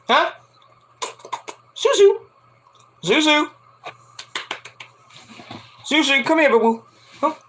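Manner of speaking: high, cooing call to a cat; pet talk. Real, not acted. Calling the pet cat over.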